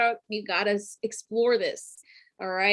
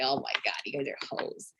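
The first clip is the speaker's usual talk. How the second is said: muttered aside; 8 semitones lower, 11 dB quieter, faster than usual. Retold or imitated. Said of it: "So I'm just like".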